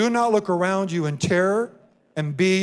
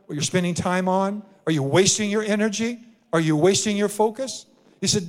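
ordinary talk

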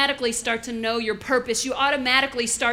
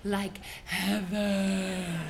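savoring; 5 semitones lower, 6 dB quieter, much slower than usual. Acted. Savoring the dessert memory.

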